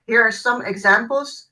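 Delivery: ordinary talk, nothing marked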